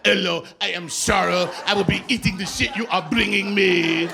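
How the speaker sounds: a hint of French waiter, mirroring him; mock French accent. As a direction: echo the waiter's French accent back at him, tongue in cheek. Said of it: "I speak to people in whatever accent".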